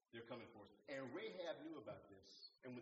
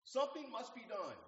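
emphatic echo; 10 semitones higher, 10 dB louder than usual. Real, not acted. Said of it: "preacher echoes Booth's command".

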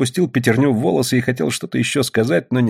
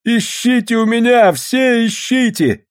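high drawn-out begging cry; desperate plea; 14 semitones higher, slower than usual. A narrator's reading. Beaten thief begging to be searched, high desperate plea. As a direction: plead high, stretching the last words.

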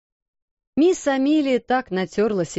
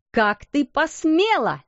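slower, higher furious repeat; angry outcry; 6 semitones higher, slower than usual. Acted. Headmistress catches the maid, slow furious outcry. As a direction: hurl the question at her. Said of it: "вскричала она".

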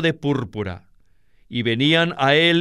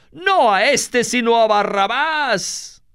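high, drawn-out crowd cry; 7 semitones higher, slower than usual. A narrator's reading. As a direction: call it out like a clamouring crowd. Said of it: "todos dieron voces de nuevo". (clamor de la multitud)

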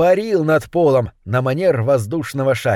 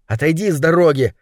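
ordinary delivery

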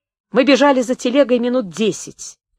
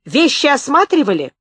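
high, raised questioning cry; shouted interrogation; 6 semitones higher, 4 dB louder than usual. A narrator's reading. Gunman interrogating the journalist about luggage, shouted high. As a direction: fire the question like an accusation.

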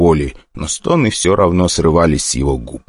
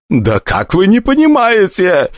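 higher, slow tearful plea; tearful despair; 13 semitones higher, slower than usual. Acted. The summoner reaches out to Stronger in tears, higher. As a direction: break down in tears. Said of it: "залился слезами".

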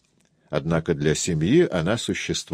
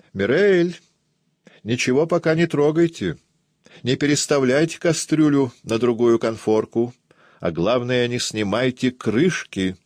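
raised, higher-pitched call to the kitchen; 6 semitones higher than usual. A narrator's reading. Aunt shouts kitchen orders to the cook, calling out. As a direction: call firm orders through the door. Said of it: "Она открыла дверь и крикнула".

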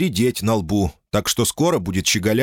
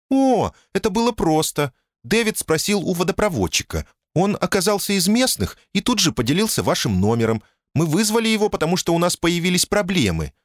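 much higher, tense woman's voice; high thin voice; 12 semitones higher than usual. A narrator's reading. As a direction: voice her high and tight. Janice explains on phone.

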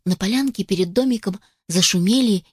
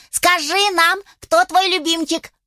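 excited chicks; 9 semitones higher than usual. Acted. Chicks eagerly ask mother.

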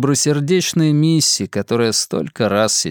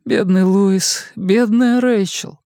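high, slow weepy pity; tearful pity; 8 semitones higher, slower than usual. Acted. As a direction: lament them through tears. Missy grieving aloud.

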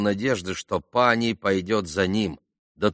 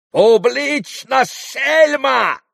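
very high, slow, loud insult; enraged scream; 7 dB louder, much slower than usual. A narrator's reading. Enraged pan threatens Grushenka with his fist, screaming. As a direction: scream it in furious humiliation. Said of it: "закричал".

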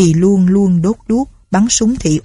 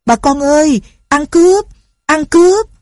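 much higher, louder alarm cry; booming shout; 9 semitones higher, 4 dB louder than usual. A narrator's reading. Father fleeing the cave, shouting to villagers, very loud and high. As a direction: yell "thief" to rouse the whole village. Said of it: "nói lồng lộng".

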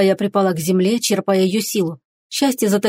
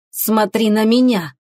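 ordinary delivery, nothing marked

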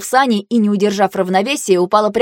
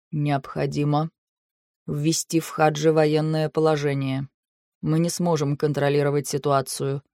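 low, soft, measured delivery; very calm; 4 semitones lower, 7 dB quieter than usual. A narrator's reading. Shahrein urging military law, chillingly calm and quiet. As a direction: state it evenly, voice held down. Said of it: "Настолько спокойно".

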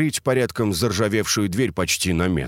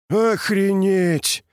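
higher, slow drawn-out awe; amazed; 11 semitones higher, much slower than usual. A narrator's reading. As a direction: stretch it out in wonder. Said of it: "восхищённо произнёс Вергилий".

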